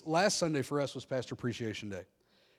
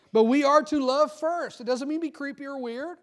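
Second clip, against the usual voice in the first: emphatic preaching; 12 semitones higher, 9 dB louder than usual. Real, not acted. Pastor preaching loudly.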